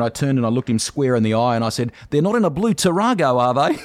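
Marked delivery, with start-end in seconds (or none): 2.1-3.8 loud, raised punchline; spring the gotcha on him; mock-innocent question, called out high and loud; prank reveal.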